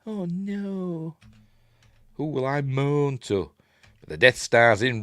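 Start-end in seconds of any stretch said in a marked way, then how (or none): 0.1-3.5 lightly voiced fussy droid, high; a hint of C-3PO fretting. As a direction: whine it like the worried robot. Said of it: "and three P O goes".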